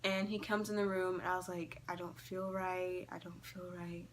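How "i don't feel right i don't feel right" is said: soft, fading panicked complaint; retold weakly, trailing softer; weak, uneasy plea. Telling dad about the panic attack, weak and soft.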